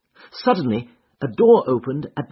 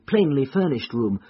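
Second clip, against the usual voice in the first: usual speaking voice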